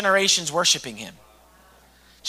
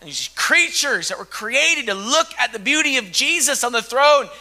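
ordinary talk

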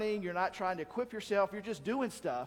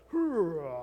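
mock flexing grunt; 5 dB louder than usual. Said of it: "And he goes".

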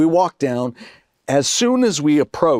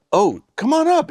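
much higher, delighted come on up; surprised invitation. Retold or imitated. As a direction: caught off guard, pleased. Surprised friend inviting him up.

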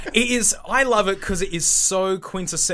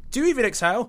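higher-pitched questioning voice; raised voice for the other character; impression setup; 7 semitones higher, faster than usual. Mike Nolan impression exchange.

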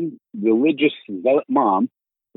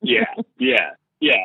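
ordinary talk, nothing marked

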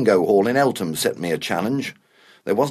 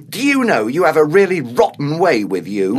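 raised, loud indignant complaint; angry accusation; 4 semitones higher, 6 dB louder than usual. Acted. Older man accusing his housemate of rudeness, angry. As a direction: let the resentment out.

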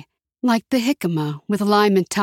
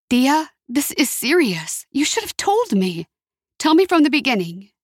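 urgent plea; 6 semitones higher than usual. A narrator's reading. Luz urges her aunt.

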